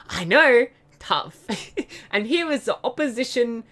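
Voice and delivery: normal talking voice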